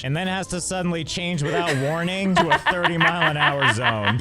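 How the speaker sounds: rising into a high laughing squeak; laughing rant. Real, not acted. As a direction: laugh harder as it builds. Sarcastic rant about speed limits.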